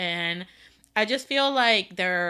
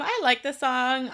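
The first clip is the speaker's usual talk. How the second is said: ordinary delivery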